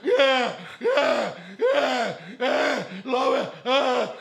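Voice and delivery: a high, loud, slow wailing cry of laughter; helpless laughing shriek. Retold or imitated. Guest on the floor shrieks, helpless with laughter. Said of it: "huudat avuttomana lattialla vaan".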